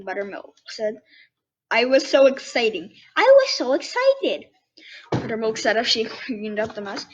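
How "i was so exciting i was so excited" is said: hop with excitement; excited bunny child. Acted. Bunny retake louder.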